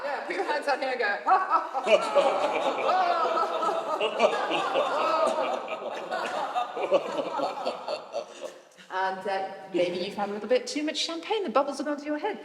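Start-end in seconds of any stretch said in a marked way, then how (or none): 1.2-8.6 long loud belly laugh, higher; big belly laugh. Shown as a demonstration. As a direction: roar a gloating laugh. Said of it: "Big belly laugh".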